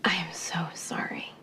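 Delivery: soft unvoiced whisper; whispered apology. Wife whispering her regret to her husband.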